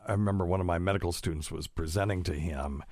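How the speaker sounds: usual speaking voice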